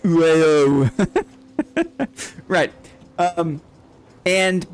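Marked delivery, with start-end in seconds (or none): 0.0-0.9 loud, slurred run of vowels; exaggerated French vowel glide; mock French. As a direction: blur the vowels into one mock-French noise. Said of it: "All French words are spelled like".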